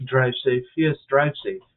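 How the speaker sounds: ordinary talk, nothing marked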